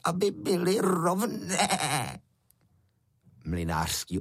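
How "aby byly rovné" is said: high-pitched creature voice, last words dragged out; croaking water goblin. A narrator's reading. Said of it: "skřehotavý hlas vodníka".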